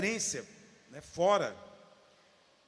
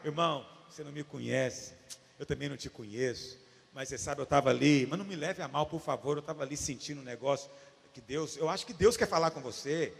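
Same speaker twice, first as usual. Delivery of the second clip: cautious, humble approach; 7 semitones lower than usual. Retold or imitated. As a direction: feel your way in gently and apologetically. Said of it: "eu cheguei com muita cautela".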